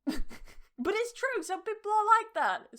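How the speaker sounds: high, loud, laughing protest; amused insistence. Real, not acted. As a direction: insist through real laughter. Insists while laughing at own bluntness.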